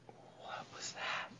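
slow, hushed whisper of disbelief; stunned whisper. Retold or imitated. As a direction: breathe it out, dazed. Deuce stunned after the role-play.

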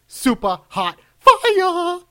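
drawn-out, high shouted catchphrase. Retold or imitated. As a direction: belt it like an announcer's hype line. Echoing the show's catchphrase intro.

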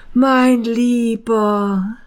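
thin man beginning after the greeting; thin man fond. A narrator's reading. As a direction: savour the words fondly. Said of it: "begann der Dünne".

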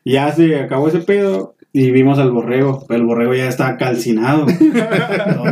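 ordinary talk

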